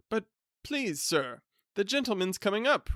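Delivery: anxious servant with a warning; servant's voice. Acted. Servant warning his master the visitor is coming, nervous.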